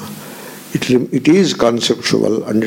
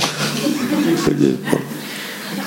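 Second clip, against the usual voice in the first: soft laugh; 5 dB quieter than usual. Real, not acted. Laugh after the joke.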